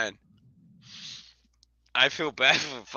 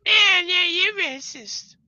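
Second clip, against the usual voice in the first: much higher, slow sing-song mock voice; mocking accusers; 5 dB louder, slower than usual. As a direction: drag it out like a taunting crowd. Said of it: "They're like".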